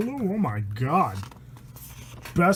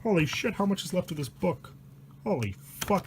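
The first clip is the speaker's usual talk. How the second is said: usual speaking voice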